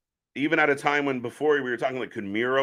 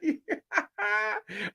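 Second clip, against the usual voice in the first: high burst of laughter; 6 dB quieter than usual. Real, not acted. Amused by Divas title match.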